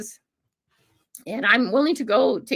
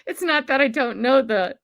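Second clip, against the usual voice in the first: usual speaking voice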